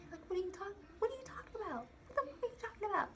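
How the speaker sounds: high, crying disbelief; shocked disbelief. Retold or imitated. To the manager choking her, high shocked cry. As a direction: squeal out the confusion, near tears. Retelling confused reaction to being choked.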